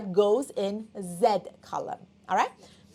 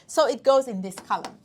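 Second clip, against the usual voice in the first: ordinary talk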